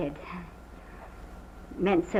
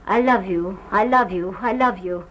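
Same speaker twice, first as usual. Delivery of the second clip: urgent repeated love; 2 semitones lower, 7 dB louder than usual. Retold or imitated. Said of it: "He repeated it just like that".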